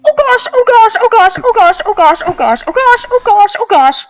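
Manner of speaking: high, loud repeated fluster; frantic high-pitched elf in distress; panicked muttering from the back room. Acted. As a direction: rattle it off in a rising panic. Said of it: "you hear muffled from the back".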